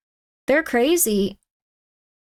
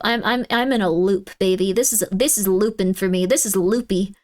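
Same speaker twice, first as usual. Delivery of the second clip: ordinary talk, nothing marked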